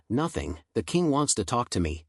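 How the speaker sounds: usual speaking voice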